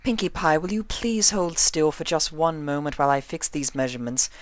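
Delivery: prim fashionista scolding a fidgety friend; Rarity's voice. A narrator's reading.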